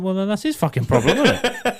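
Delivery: exasperated retort. Retold or imitated. Retelling the retort to his boss, laughing and indignant. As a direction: throw it back at the boss, outraged. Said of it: "I went, I went".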